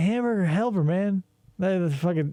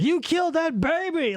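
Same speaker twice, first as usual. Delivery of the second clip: detective yelling; 10 semitones higher than usual. Retold or imitated. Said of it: "it's like".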